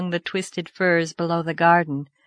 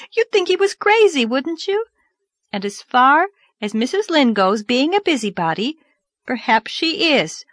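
put-on indignant girl; 6 semitones higher, 4 dB louder than usual. Narrator voices Anne.

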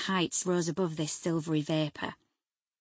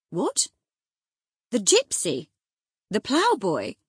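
incredulous exclamations, pitched up and louder; astonished cry; 4 semitones higher, 8 dB louder than usual. A narrator's reading. Master hears from his servant who has come, astonished cry. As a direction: cry out each question in disbelief. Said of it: "he cried".

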